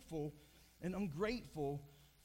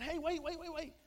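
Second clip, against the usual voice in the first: higher, raised mock yell at a dog; 8 semitones higher than usual. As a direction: call the dog back in a playful shout. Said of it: "this man begins to yell at the dog".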